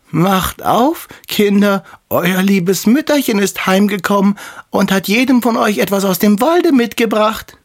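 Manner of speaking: wolf faking a high, fine mother's voice; disguised wolf. A narrator's reading. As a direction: coax the kids in high falsetto. (verstellter Wolf mit feiner, hoher Stimme)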